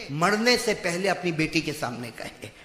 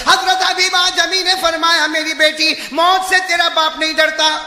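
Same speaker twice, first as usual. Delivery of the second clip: loud, high fire-and-brimstone sermon; shouted preacher's exhortation; full-force preaching; 10 semitones higher, 10 dB louder than usual. Acted. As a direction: thunder the warning at the whole crowd.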